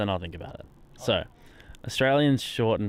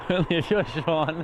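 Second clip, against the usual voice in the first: higher, slowed by real laughter; laughing; 5 semitones higher, slower than usual. Real, not acted. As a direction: repeat it through laughter. Repeating a joke while laughing.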